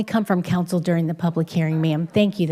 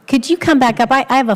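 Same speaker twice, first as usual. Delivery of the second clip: raised, higher call across the room; 6 semitones higher, 6 dB louder, faster than usual. Real, not acted. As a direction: call him back up to the microphone. Chair calls speaker back to podium.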